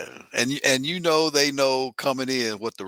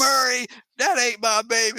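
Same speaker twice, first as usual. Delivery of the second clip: high-pitched mock denial; hammed-up paternity denial; protesting talk-show guest; 10 semitones higher than usual.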